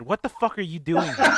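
loud, laughing disbelief; hammed-up shock at her; incredulous outburst.

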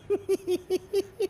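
sudden high-pitched burst of laughter. Real, not acted. Laughing at his own story, high burst of laughter. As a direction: let a high laugh break out.

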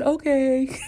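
high, small-voiced compliance; comic high-voiced surrender; meek giving in. Acts own chastened reply.

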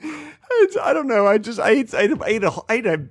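high, squeaky laughing-crying voice; laughing to tears. Real, not acted. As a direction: struggle to get words out through helpless laughter. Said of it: "I'm laughing so hard I'm crying".

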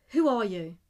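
fearful challenge to a stranger; frightened. A narrator's reading.

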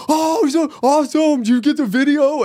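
teasing a friend about his glee; mock excited. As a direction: imitate a thrilled friend. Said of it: "you were like".

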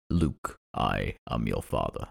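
very low, slow villain voice; Darth Vader. Acted. Quoting the famous Star Wars misquote, deep slow villain impression. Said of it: "Star Wars and the whole".

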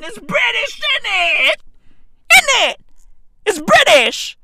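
high, increasingly loud British catchphrase; escalating mock British. Comic capping his Russians-in-London bit, mock-British yelling.

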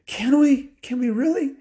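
put-on high, eager kid voice; excited children. Girls offered a sleigh ride, thrilled and eager.

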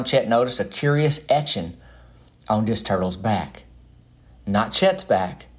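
normal talking voice

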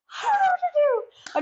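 very high, loud helpless wail, hammed up; mock panic. New girl stranded in the school hallway, mock-panicked squeal.

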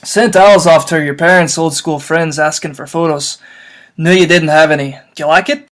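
loud, booming half-giant; blubbering big gamekeeper; Hagrid voice. Acted. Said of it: "is this me again".

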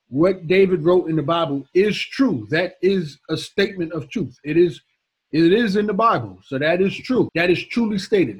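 insistent preacher pounding the point; emphatic repetition. Shown as a demonstration. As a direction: drive "truly stated" in line after line so it sticks. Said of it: "I'm emphasizing, I'm saying it over and over again".

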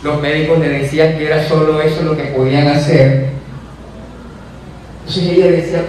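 ordinary talk, nothing marked

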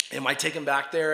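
ordinary delivery

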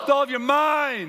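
high, loud proclaiming shout; shouted call to the congregation; with chutzpah. Real, not acted. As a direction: call it out with guts to the crowd. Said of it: "with some guts".

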